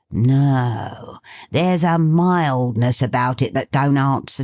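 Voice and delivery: put-on old father savouring the smell; old Toby. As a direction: sniff and savour like an old man.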